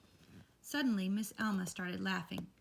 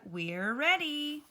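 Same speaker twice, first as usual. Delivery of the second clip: high, slow and loud call; called out slowly; 8 semitones higher, 6 dB louder, much slower than usual. A narrator's reading. Amelia Bedelia calls to the party, drawn out and loud. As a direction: sing it out to the yard. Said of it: "called Amelia Bedelia".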